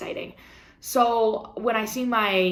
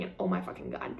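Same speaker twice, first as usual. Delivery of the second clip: breathless whisper, much lower and softer; whispered exclamation; 9 dB quieter, faster than usual. Real, not acted. Vlogger overwhelmed by good news, whispering. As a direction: let it slip out under your breath.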